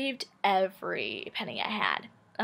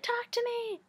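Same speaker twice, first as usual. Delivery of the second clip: very high, sing-out pleading squeal; mock crazy-girl demand. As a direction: put on a crazy clingy girl's high voice. Mimicking a crazy girl's demand.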